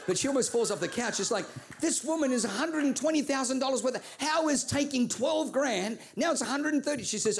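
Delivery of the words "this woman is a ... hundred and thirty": a touch higher, incredulous; Oprah's disbelief. Acted. Oprah doubting the expert's advice, mildly incredulous. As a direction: question it in mild disbelief.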